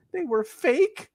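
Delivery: high squeaky cartoon voice; cartoon character voice. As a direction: squeak it like a shocked cartoon sidekick. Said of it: "Patrick and SpongeBob going".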